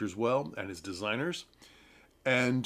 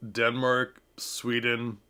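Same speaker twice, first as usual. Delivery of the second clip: ordinary talk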